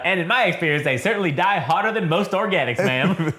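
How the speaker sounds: mannered, polite protocol droid; 1930s announcer droid. Acted. Droid offering assistance to masters, in mannered announcer voice. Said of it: "like the nineteen thirties announcer guy".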